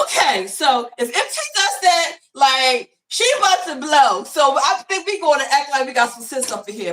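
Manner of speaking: hammed-up kids warning one another; mock student voices. Students warning each other the teacher will blow, mock alarmed voices.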